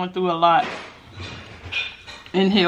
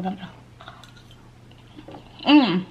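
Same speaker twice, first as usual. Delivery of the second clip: talking while chewing food; muffled words around a bite; fork in mouth, chewing. Real, not acted. Seen 2: Fork with greens enters mouth 1.0–1.9s, then closed-mouth chewing follows.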